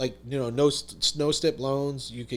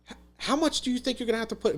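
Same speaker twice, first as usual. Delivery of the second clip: much higher, fast, rattled question; alarmed father; 10 semitones higher, faster than usual. Retold or imitated. Contractor dad quizzing his son on repair money, fast alarmed question. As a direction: rush it out, alarmed.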